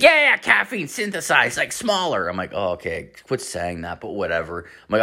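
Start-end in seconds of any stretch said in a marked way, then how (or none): 0.0-2.3 high-pitched caffeine-addled babble; mock wired voice, pitched way up; imitating wired friend. 2.5-4.6 low, soft brush-off; quiet, unimpressed acted reply; dismissive aside. Dismissive reply to babbling friend.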